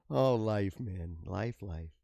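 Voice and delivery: low, soft sigh of defeat; a hint of a broken man muttering; mock despair. Hero laments his life to himself, sighing low and soft.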